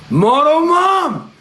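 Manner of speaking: long, high wail of the name; dismayed cry. Real, not acted. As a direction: call it out high and drawn out. Cries out after being sniped.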